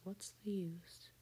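plain reading voice

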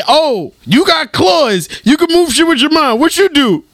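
mock challenge. Comic sizing up a mutant with claws, mock challenge.

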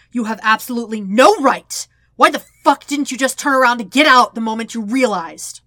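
enraged shouted demand; furious accusation. Acted. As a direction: tear into him. Zuko furious at the intrusion.

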